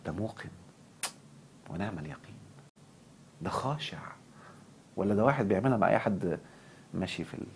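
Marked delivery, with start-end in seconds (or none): 0.1-4.0 much softer, hushed admiring words; awed murmur. Retold or imitated. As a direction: murmur in quiet reverence as a passerby. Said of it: "أنا معدي كده أقول". (همس بإعجاب وخشوع)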